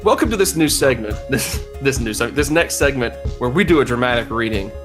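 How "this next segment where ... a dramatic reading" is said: usual speaking voice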